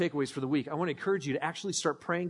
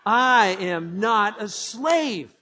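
louder, very slow; bold declaration; 3 semitones higher, 6 dB louder, much slower than usual. Shown as a demonstration. Pastor leading the church in a final proclamation. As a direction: declare it together.

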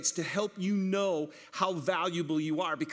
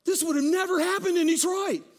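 amazed; 9 semitones higher, 4 dB louder than usual. Retold or imitated. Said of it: "Jeff was just like".

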